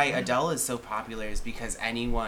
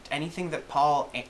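ordinary talk

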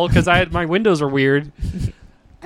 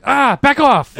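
sharp raised bark; mock yell; 7 semitones higher, 5 dB louder, slower than usual. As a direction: snap it out loud and high. Retold yelling at someone nearby.

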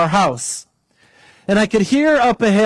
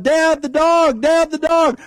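put-on high, slow, calling out like a scared child; high child's shout for her dad; panicked little girl; 9 semitones higher than usual. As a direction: cry for daddy, much higher. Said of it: "this girl is freaking out".

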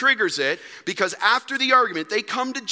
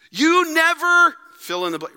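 mock marriage complaint; 10 semitones higher, 6 dB louder than usual. Spouse accusing the other in an argument, loud and whiny. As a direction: nag your spouse with always. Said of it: "Don't we say this in our marriages?".

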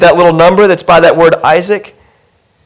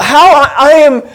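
put-on higher, slow, wondering old man's voice; old Abram; 6 semitones higher than usual. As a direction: play Abram marvelling at his old age. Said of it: "he is thinking to himself".

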